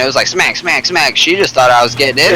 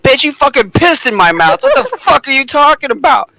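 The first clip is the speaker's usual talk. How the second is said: much higher, mock furious retort; retold outraged yell; 12 semitones higher than usual. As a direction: fire it back indignant, pitched way up. Retold outburst at the girl.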